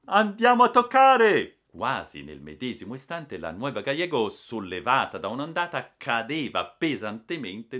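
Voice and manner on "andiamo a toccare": high, very loud warning cry; thundering shout. A narrator's reading. Balboa warns the ship will strike, thundering. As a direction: bellow the alarm to the whole ship. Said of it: "tuonò".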